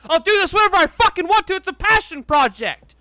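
high, loud, fast outburst; hammed-up indignant yell; defiant rant. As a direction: snap back at complaining viewers. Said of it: "It's like".

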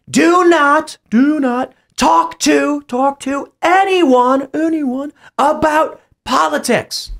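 high, slow, drawn-out chant; imitating protest organizer. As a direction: call and echo like a crowd repeating a leader. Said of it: "one organizer commanding the group".

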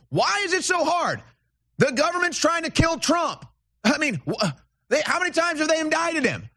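rant, voice climbing high with exasperation. Real, not acted. Host ranting at caller Miss Lou, voice pitched high. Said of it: "I'm ranting here".

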